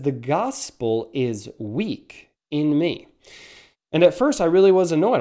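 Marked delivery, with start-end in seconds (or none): 0.0-3.1 slow, measured reading of Luther's line; Luther's sentence read out slowly; slow quoted line. Retold or imitated. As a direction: let each word land slowly, like a verdict. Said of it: "It says".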